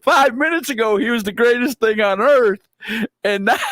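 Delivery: high-pitched, baffled comic protest; mock disbelief. Onlooker baffled by the girl's turnaround, high mock disbelief. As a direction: retell your bewilderment in a high voice. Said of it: "I'm just looking over like".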